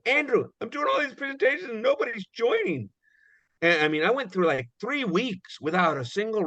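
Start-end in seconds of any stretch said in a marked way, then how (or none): 0.1-2.9 much higher, plaintive whine to a mentor; whiny complaint. Retold or imitated. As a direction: complain like a frustrated rookie. Complaining to his mentor Andrew.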